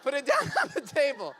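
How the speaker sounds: loud telling through laughter; laughing. Real, not acted. Storyteller recalling his first shot, laughing. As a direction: crack up while getting the words out.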